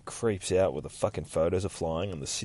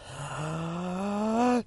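mock gasp; 8 semitones higher, 5 dB quieter than usual. Mock panic over meditation.

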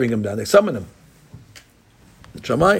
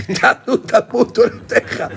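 high, mock tearful voice, half laughing; imitating breaking down; 10 semitones higher than usual. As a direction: act out his voice cracking with tears, lightly. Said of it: "Chama Vodah would break down".

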